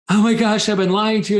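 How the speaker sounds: high, loud, breaking confession; tearful confession. Retold or imitated. Confessing to his girlfriend while bawling, high and loud. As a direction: blurt it out like you are finally cracking. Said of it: "I go".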